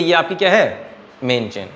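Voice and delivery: normal talking voice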